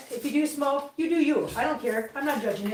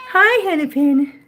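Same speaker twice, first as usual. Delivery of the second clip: loud greeting; 3 semitones higher, 9 dB louder than usual. Real, not acted. Greeting cat.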